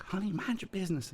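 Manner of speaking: high, scolding Southern wife; Southern mom. Acted. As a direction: hush him like a Southern wife. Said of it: "And the girl's like".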